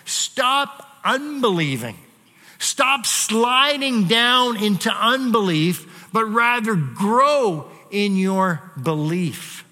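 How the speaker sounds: slowed, earnest exhortation to stop doubting; urgent plea. Retold or imitated. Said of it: "he's saying".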